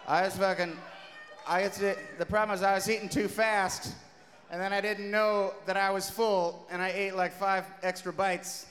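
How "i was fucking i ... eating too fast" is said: ordinary talk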